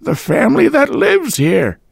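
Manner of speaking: put-on high, thin old landlady voice; a deaf old woman fobbing him off, high-pitched. A narrator's reading. Landlady brushing off the visitor at the door, high old-woman voice.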